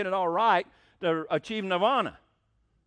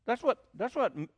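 usual speaking voice

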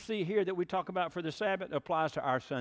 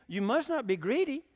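lightly voiced agent scolding; 9 semitones higher, 4 dB louder than usual. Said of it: "he said to the".

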